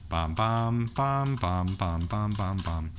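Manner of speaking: each bam punched on the downbeat; beat syllables. Shown as a demonstration. Teacher showing the downbeat, punchy bam bam. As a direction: punch every bam like a kick drum. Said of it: "emphasis on the first and second downbeat".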